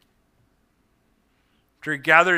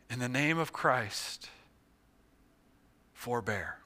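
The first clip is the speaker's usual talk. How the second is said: soft, low, fading plea; dying call; 5 semitones lower, 12 dB quieter than usual. Acted. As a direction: call out with your last air. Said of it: "what air he still had in his lungs".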